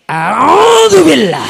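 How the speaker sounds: long, high, loud drawn-out chant; shouted recitation. Acted. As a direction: bellow the prayer like a battle cry. Soldier recites loudly at deathbed.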